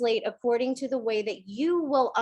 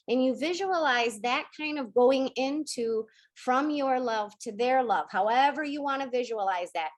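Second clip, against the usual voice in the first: ordinary delivery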